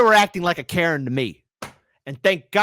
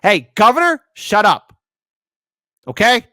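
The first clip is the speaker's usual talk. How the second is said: higher and louder, telling him off; mocking yell; 8 semitones higher, 10 dB louder than usual. Real, not acted. Host yelling at the governor's clip, mocking shout. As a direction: shout him down half-joking.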